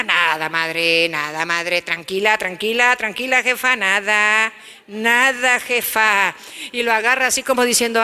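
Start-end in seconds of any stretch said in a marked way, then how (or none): 0.0-6.3 low, drawn-out calming, nada, madre; smooth-talk mom to drop it; evasive teen. Acted. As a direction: son hiding his coke tube from his mother. Teen soothing mother, hiding coke.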